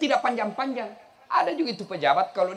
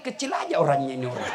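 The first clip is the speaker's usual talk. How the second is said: mock regent's wife; 7 semitones lower, faster than usual. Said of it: "Ibu Bupati tadi bilang".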